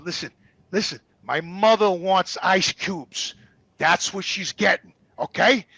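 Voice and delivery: low, deliberate, measured warning; slow, firm insistence. Retold or imitated. Son overruling the nurse on ice cubes, firm and slow. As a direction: lay it down word by word.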